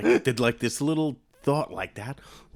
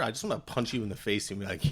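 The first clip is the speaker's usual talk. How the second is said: ordinary delivery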